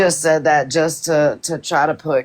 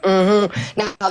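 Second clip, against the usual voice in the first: usual speaking voice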